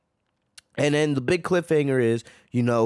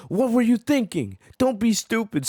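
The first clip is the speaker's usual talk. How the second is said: mock scolding; 9 semitones higher than usual. Goku telling off Granola and Vegeta, high scolding. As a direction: scold them like a fed-up friend. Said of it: "Goku's just like".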